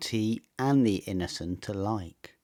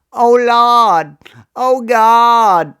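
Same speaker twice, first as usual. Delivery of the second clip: put-on high, loud Caribbean-accented cry; Caribbean exclamation; 11 semitones higher, 12 dB louder, slower than usual. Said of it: "he would exclaim".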